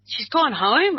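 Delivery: high, rising echo of the news; retold startled question; shocked disbelief. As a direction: repeat it back in disbelief. Hears grandma left the kids.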